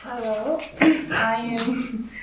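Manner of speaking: ordinary talk, nothing marked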